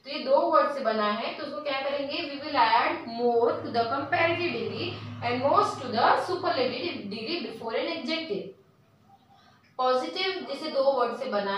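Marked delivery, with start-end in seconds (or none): 2.3-8.5 reads the rule very slowly, word by word; slow dictation. Shown as a demonstration. Teacher dictating a grammar rule to students, very slow.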